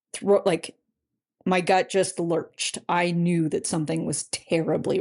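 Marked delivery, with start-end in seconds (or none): none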